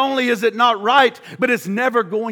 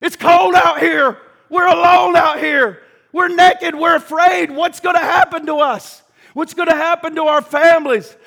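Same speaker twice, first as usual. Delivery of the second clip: mock despair; 7 semitones higher, 5 dB louder than usual. Preacher voicing Adam and Eve outside Eden.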